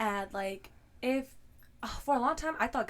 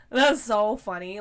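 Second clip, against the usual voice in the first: slow, louder, dry mock amusement; sarcastic amusement; 8 dB louder, slower than usual. Hearing gossip about them, slow and louder in mock amusement. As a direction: drawl it with ironic disbelief. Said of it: "because I'm like".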